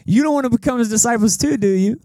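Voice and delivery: sarcastic. Retold or imitated. Healed man mocking the Pharisees' questions, sarcastic. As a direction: imitate a frustrated, sarcastic retort. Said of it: "other than sarcasm".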